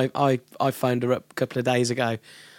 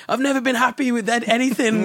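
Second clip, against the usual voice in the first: high-pitched imitated lament; freaking out; 9 semitones higher than usual. Imitating the artist bemoaning her lost record, freaking out. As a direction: mimic an upset artist, pitched up. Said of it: "she'd be freaking out".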